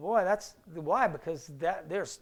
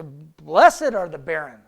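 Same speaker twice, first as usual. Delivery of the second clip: proclaiming; 5 semitones lower, 9 dB louder, slower than usual. Acted. Preacher proclaims verse.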